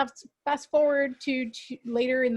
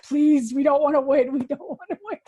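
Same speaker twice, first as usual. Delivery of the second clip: loud, hammed-up begging; mock pleading; 3 semitones higher, 8 dB louder than usual. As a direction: plead comically, pushing the words out. Retold dread of cursed award.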